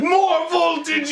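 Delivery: high, stretched mad-scientist cry; mock scream. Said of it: "he's screaming, it's really loud".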